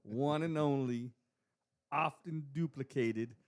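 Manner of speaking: drawn-out, loud hype-man intro; announcer introduction. Acted. Co-host introducing DJ guest to listeners, grand announcer build-up. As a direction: announce the guest slowly with big, punched words.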